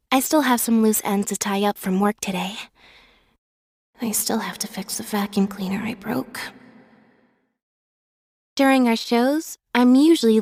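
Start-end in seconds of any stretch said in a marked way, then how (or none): 4.0-6.4 soft guilty whisper. Acted. Admitting a broken vacuum cleaner, a soft whisper. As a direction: confess it under your breath.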